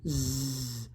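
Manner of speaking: low, droned letter Z; dull, toneless reading of Z; boring Z. Shown as a demonstration. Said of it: "which is kind of boring".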